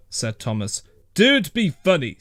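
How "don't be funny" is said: snapped. A narrator's reading. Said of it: "snapped the policeman".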